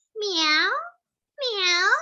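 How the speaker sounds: high, rising cute meows; a sweet little kitten asking nicely; sweet cat. Shown as a demonstration. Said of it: "Kucing yang manis misalnya". (kucing manis mengeong tinggi)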